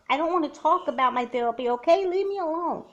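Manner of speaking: loud, high, rising snap of a yell; yelling at her friend to back off, rising to a shout. Acted. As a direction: shove them away with your voice. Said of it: "Don't yell at my sister like that".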